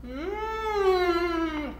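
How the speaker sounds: long savoring hum; yummy mmm. Real, not acted. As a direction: hum with pleasure. Tasting the ice cream.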